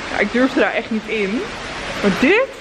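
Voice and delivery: ordinary talk, nothing marked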